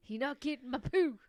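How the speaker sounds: high, loud put-on voice; mock defiant cook. Cook refusing the investigator samples, mock defiant. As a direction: refuse with hammed-up outrage.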